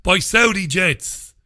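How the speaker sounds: ordinary talk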